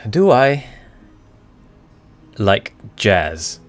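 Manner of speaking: normal talking voice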